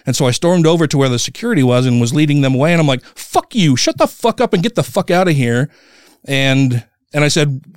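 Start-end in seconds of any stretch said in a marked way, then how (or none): 3.1-5.7 higher-pitched, understated retelling of fury; retold anger. Storming over to the intruders, mildly angry retelling. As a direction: re-enact telling intruders off, without real volume. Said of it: "I got really fucking mad".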